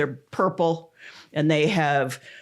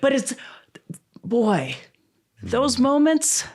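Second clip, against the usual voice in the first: choked up; 8 semitones higher, slower than usual. Real, not acted. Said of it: "I'm holding it together".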